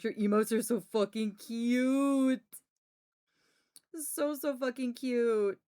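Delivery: slow, gushing cute praise. Real, not acted. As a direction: gush over how cute it is. Gushing over emotes.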